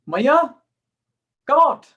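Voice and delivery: loud, high call from outside. Acted. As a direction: call someone out of the house. Gopis calling Mother Yashoda outside.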